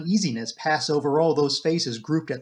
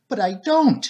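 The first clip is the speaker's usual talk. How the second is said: raised, anguished cry; a higher distressed protest; near wail; 5 semitones higher, 3 dB louder than usual. A narrator's reading. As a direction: cry it out, on the edge of wailing. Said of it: "cried Ursula, almost in a wail".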